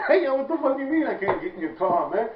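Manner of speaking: laughing through an outraged mock shout; half-laughing, half-crying indignant yell; laughing protest. To his friend, about being refused the car, laughing indignant shout.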